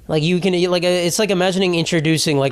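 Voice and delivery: usual speaking voice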